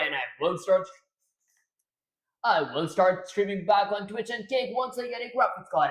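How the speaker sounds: loud radio announcer outro. Acted. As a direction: deliver it like a radio host. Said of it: "this does not sound like a radio voice anymore".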